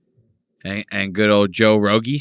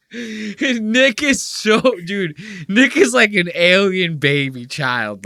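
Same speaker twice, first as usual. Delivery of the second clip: high, slow, gushing voice; 8 semitones higher, slower than usual. Real, not acted. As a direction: coo it out in a high voice. High-pitched gushing over Nick.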